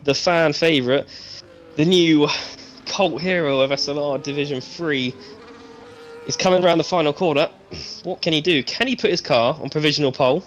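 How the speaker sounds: building excited play-by-play; race commentary. Acted. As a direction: call the lap as it happens. Live commentary on a lap.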